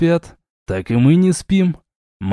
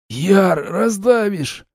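squeezed high, slow wheeze; wheezing; 7 semitones higher, much slower than usual. A narrator's reading. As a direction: wheeze out while being crushed. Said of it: "просипел я в грудь".

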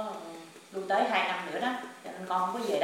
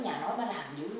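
normal talking voice

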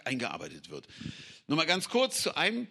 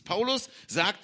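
ordinary talk, nothing marked

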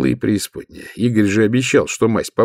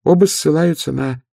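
high nasal voice; 6 semitones higher than usual. Acted. Nobby speaks.

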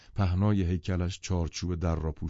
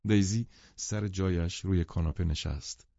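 ordinary delivery

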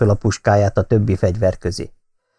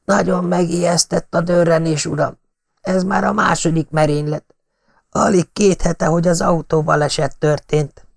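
lightly voiced old butler, a bit shaken; an old servant still startled by the gunshot; flustered old servant; 7 semitones higher than usual. A narrator's reading. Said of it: "Az öreg zavartan pislogott".

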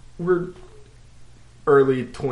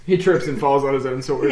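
ordinary talk, nothing marked

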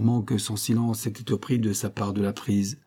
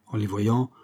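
usual speaking voice

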